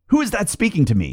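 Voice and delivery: high, fast startled cry; mild scared call into the dark; frightened cry. Acted. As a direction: blurt it out in fright. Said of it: "he cried".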